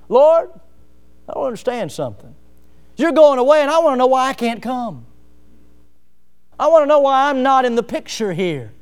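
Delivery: Peter demanding. Retold or imitated. Peter demanding to know why he can't come, loud and high. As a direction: press the Lord for an answer. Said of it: "that's what Peter was trying to do".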